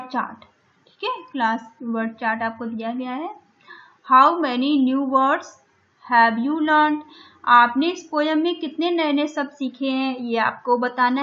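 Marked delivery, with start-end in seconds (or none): 4.1-7.0 slow task reading. Shown as a demonstration. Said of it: "teacher reading the task".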